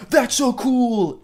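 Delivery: high, slowed robotic game-character line; NPC dialogue. Retold or imitated. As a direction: deliver it like scripted NPC chatter. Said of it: "N P C dialogue".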